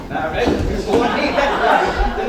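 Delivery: laughing exasperation. Acted.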